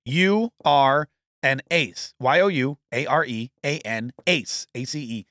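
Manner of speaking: very slow, letter by letter; spelled out slowly. Shown as a demonstration. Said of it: "spelling out the web address".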